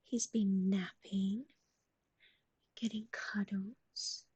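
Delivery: slow breathy whisper, drawn out. Acted. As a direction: whisper it slowly, soothing. Whispers about dog's day.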